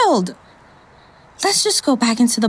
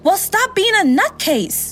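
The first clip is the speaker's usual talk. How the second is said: defiant; 8 semitones higher than usual. Acted. Defiant retort to gunman.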